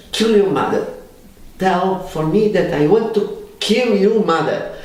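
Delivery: loud, high retold order to kill mother; high, loud mock command. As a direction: bark it like the stern therapist.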